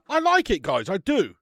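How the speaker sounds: excited approval for the viewers; enthusiastic verdict. Real, not acted. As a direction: sell the liking with a jump in energy. Verdict on beer.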